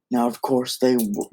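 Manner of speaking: ordinary delivery, nothing marked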